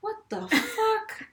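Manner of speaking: slow, pitched far up in disbelief; mock disbelief. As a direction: stretch out the shock, incredulous. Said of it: "I'm like".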